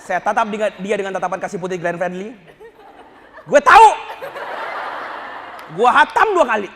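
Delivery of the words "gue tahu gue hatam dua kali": loud, high snap back; indignant retort. Retold or imitated. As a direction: put the know-it-all kid in her place. Retort to a lecturing girl. (bentakan balik yang keras)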